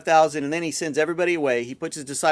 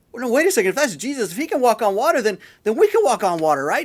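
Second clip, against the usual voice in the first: higher, louder, eager realization; eager Peter; 5 semitones higher, 5 dB louder, faster than usual. Retold or imitated. Disciple reasoning he can do what Jesus does, acted eagerness. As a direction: play someone excitedly working out a bold idea. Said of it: "but Peter's like".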